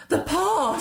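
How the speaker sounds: high, loud, wild cry. A narrator's reading. Wife waking her husband at night, crying out wildly. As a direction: burst out with sudden idea. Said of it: "she cried wildly".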